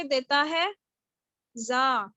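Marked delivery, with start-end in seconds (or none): 1.6-2.0 زا with a clear z; zaa demo. Shown as a demonstration. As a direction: say زا, buzzing the z. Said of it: "زا کس کی sound دیتا ہے". (زا، زی کی آواز کے ساتھ)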